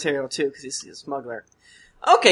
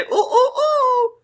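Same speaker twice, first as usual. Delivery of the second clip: very high silly hoots; fool's hooting; much slower than usual. Acted. Mocking Westeros jesters, very high silly hoots. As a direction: hoot like a capering jester. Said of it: "jump around and say".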